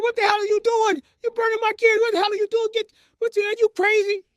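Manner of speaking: very high, frantic outrage; mother screaming. Retold or imitated. Mother to father burning her kids, screaming. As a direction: imitate a horrified mom shrieking.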